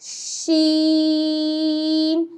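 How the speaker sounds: شین with a long sh held out; drawn-out sheen. Shown as a demonstration. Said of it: "شین آپ کو پڑھا جائے گا".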